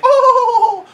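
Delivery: high, loud delighted yelp with a laugh; surprised squeal. Retold or imitated. Flattered girl squeals at a boy's compliment, high and loud. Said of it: "then she's like".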